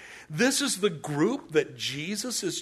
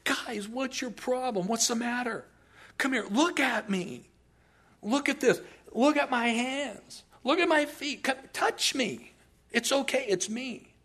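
reassuring Jesus; 8 semitones higher than usual. Retold or imitated. He gives them proof.

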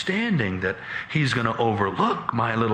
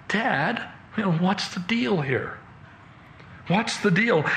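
high, plaintive questioning; bewildered appeal; 8 semitones higher than usual. Acted. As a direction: question Dad in disbelief, pitched right up. Bewildered son appeals to father.

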